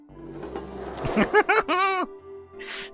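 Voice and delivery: high-pitched burst of laughter; real squealing laugh. Real, not acted. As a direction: break into a loud, high laugh. Reader breaks into laughter.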